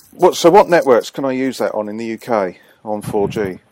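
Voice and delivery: ordinary talk, nothing marked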